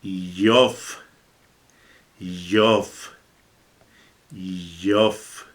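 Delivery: teacher sounds out Yof; low reading demo. Shown as a demonstration. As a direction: model Yof slowly, fading each time. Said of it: "¿Y cómo se lee?".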